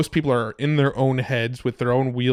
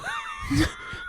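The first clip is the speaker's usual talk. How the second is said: high shrieking laugh; 7 dB quieter than usual. Real, not acted. Cracking up mid-bit.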